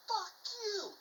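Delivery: very high, strained comeback without full volume; acted-out angry retort; retold defiant yell. Acted defiant reply to yelling captain.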